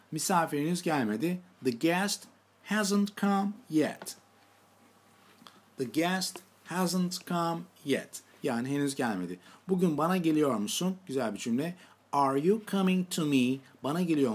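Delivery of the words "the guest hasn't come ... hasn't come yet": slow sentence read for students; careful teacher dictation. Shown as a demonstration. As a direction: spell it out slowly. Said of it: "Tekrar edelim".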